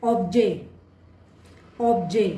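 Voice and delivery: objet, last sound silent; French word demo. Shown as a demonstration. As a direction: say ऑब्जे as French "objet", no final t. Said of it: "example". (ऑब्जे French "objet" की तरह, अंत का t चुप)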